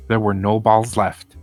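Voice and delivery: plain reading voice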